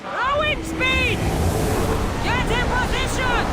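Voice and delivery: high, loud command over battle noise; yelling across the deck; shouted orders. Acted. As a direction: bellow orders to the rowers. Captain shouting orders on ship.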